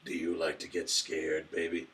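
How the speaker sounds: low, slow seductive purr. Pretend. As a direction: tease like a smooth seducer. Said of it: "This is just seductive voice".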